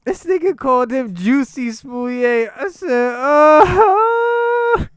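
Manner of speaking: high, loud, helpless laughing wail; real squealing laughter through the words; rolling with laughter. Real, not acted. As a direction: crack up at the fake French name, voice shooting up. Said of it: "that got me rolling".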